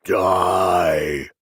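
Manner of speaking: low, loud growl; demonic growl. Acted. Possessed woman growling at the priest, low demonic growl. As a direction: spit it out like a demon. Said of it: "she growled in Latin".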